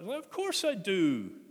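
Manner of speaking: loud, high, wounded protest; loud high-pitched hurt insistence; hurt reply. Acted. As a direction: answer as if stung by the question. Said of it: "And Peter hurt".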